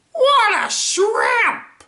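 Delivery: high, drawn-out cartoon shout; character voice. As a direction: yell the jibe at a very high pitch. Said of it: "doing the voices and the lines".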